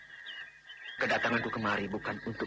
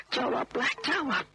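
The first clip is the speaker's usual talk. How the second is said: sharp insistent call to answer; demanding shout; 12 semitones higher, slower than usual. Acted. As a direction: order her to answer, losing patience. Demanding silent fiancée answer him.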